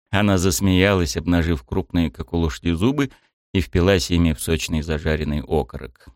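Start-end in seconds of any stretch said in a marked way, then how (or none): none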